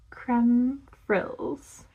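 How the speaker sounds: put-on Creme said with a nasal French vowel, trying for a French accent; a shy attempt at French on Creme frills; a tentative stab at the French name Creme frills. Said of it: "I can't really do the French accent".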